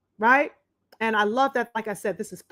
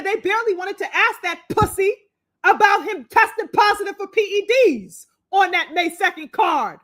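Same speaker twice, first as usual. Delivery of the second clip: pitched up and fired up, hammering each charge; heated rant; 7 semitones higher than usual. Real, not acted. Streamer ranting to viewers about the press berating Shakur, heated and raised. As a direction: vent your outrage at the hypocrisy.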